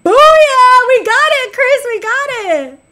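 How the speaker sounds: loud, high victory holler; triumphant shout. Real, not acted. Streamer telling a viewer they got it, triumphant shout. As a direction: start on a big booming cheer and hold the excitement. Celebrating a find with Chris.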